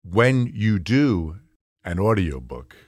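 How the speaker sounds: slow, measured audiobook pace. Shown as a demonstration. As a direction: stretch each word out with deliberate pauses. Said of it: "you speak at about this speed".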